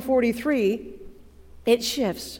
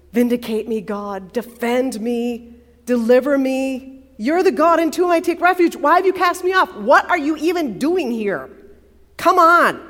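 desperate plea; 3 semitones higher, 6 dB louder than usual. Acted. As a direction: build from pleading to demanding. Pleading demand to God.

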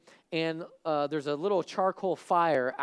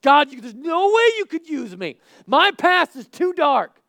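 high, loud acted plea; impassioned, anguished outburst; despairing cry to God; 12 semitones higher, 14 dB louder than usual. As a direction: protest your unworthiness at full voice.

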